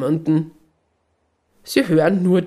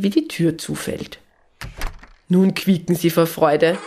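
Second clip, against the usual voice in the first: ordinary delivery, nothing marked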